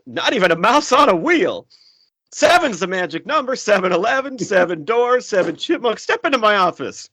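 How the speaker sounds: high put-on movie voice; character voice. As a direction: act out the creepy boss lines. Quoting a movie character's lines.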